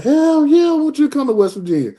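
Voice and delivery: high, loud yell of joy, then rushing on; retold scream. Coach screaming his yes down the phone to a recruit, elated yell. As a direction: belt it out like a thrilled coach. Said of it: "he just screamed out".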